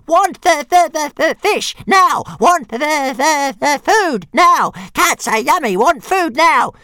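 very high, loud stuttering tantrum; shouting little dragon. A narrator's reading. Struggling dragon held by the tail, shrill shouted demands. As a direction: screech it like a greedy small creature.

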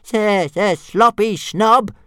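high, loud, slow jeering dragon; taunting dragon call. Acted. Dragon calling a taunt to Fireworm, high and loud. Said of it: "Toothless called out".

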